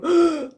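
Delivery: sharp loud gasp of panic; stressed gasp. Retold or imitated. Imitating her old stressed self, a sharp gasp. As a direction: gasp like the stress just hit. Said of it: "instantly gone into".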